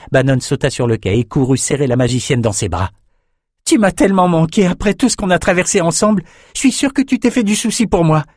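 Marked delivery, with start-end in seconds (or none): 3.6-8.2 put-on high eager boyish gush; high, eager youthful voice; eager young man. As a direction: pour out his affection. Bannon hugs her.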